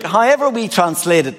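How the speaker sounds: ordinary talk, nothing marked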